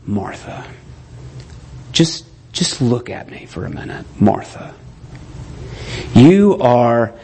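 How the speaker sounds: Jesus speaking gently to an anxious Martha; tender rebuke. Retold or imitated. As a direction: hold her face and calm her gently. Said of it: "takes her face in his hands".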